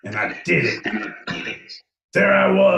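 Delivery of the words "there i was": dramatic declaration. Pretend.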